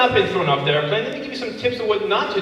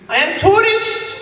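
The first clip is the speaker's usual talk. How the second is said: broken English tourist; 13 semitones higher, 3 dB louder, slower than usual. Acted.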